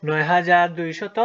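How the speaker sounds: firm slow final reading. Shown as a demonstration. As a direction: announce "নয় হাজার দুইশত" loudly and slowly to the class. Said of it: "এটির উচ্চারণ কি?".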